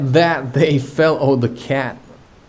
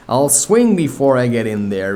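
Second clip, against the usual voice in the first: usual speaking voice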